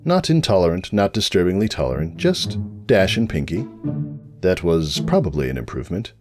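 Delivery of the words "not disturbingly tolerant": ordinary delivery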